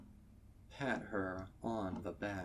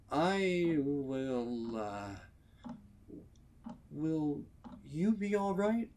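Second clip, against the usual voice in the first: awkward stallion; 7 semitones higher, 6 dB louder than usual. Acted. Stallion awkwardly checking on her.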